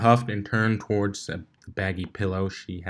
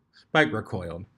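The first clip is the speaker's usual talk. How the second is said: plain reading voice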